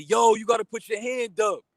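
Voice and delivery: mock the know-it-all trainer; imitating a preachy coach. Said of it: "Personal trainer on the side gonna tell me".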